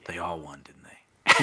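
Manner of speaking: hushed whisper aside. Real, not acted. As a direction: whisper it like a secret.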